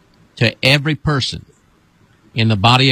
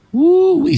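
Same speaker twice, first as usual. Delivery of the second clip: falsetto woo-wee whoop; 1 high whoop. Real, not acted. Pastor whooping during deliverance, high falsetto whoop.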